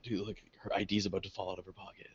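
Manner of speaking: hushed, quick heads-up; discreet aside. Retold or imitated. Nudging a friend in line behind her, hushed aside. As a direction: murmur it fast so only a friend hears.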